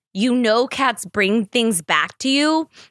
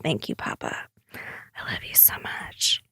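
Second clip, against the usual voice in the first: hushed little aside of affection; whispered thanks; 11 semitones lower, 11 dB quieter than usual. Real, not acted. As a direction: whisper it tenderly, drawing it out.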